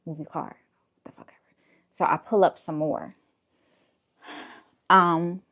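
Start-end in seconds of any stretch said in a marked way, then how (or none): 0.1-0.6 the officer's order retold in a whisper. Officer repeats his order to her, whispered. 1.0-1.5 annoyed whisper under the breath; whispered aside. Real, not acted. Annoyed driver to herself about the cop, whispered.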